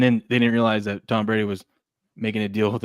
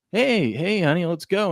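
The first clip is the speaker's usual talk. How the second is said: higher-pitched impression of a coaxing wife; wife's coaxing; 7 semitones higher than usual. Wife inviting husband to bed, higher-pitched coaxing. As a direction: play an eager wife, pitched up. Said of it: "when his wife's like".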